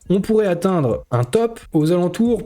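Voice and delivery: normal talking voice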